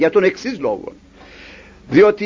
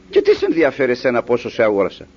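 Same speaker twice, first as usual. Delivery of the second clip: lower, quick dismissive retort; brusque master; 8 semitones lower, faster than usual. Acted. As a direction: brush the complaint aside, fast and low.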